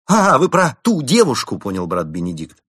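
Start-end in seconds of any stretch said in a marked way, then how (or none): 0.0-1.6 sudden realization. Acted.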